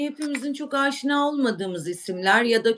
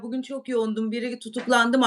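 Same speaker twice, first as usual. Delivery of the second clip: ordinary talk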